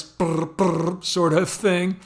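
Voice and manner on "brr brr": put-on rolled-lip brr brr like an old phone; mouth-made telephone ring, brr brr; phone ring imitation. Shown as a demonstration. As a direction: buzz your lips like a ringing telephone. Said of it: "imitating the sound of a telephone with his voice".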